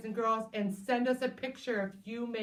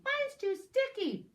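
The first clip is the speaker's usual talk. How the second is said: high whiny critter; 14 semitones higher than usual. Acted. Picky critter complains.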